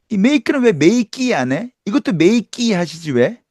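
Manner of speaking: high teasing question; teasing mockery. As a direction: needle them playfully. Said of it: "이러더라고요".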